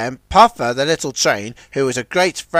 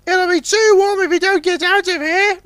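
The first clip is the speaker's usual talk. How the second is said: bright busy little tug in a hurry; tug Ten Cents. Acted. Ten Cents talks with Puffer, high and urgent.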